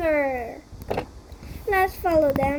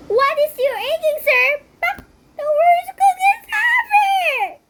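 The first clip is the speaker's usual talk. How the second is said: much higher, louder cranky voice; grumpy cookie complainer; 13 semitones higher, 7 dB louder than usual. Acted. Grumpy chicken complaining about the cookies, high cranky voice. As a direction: spit out disgust at the cookies.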